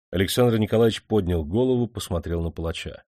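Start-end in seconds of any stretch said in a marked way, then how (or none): none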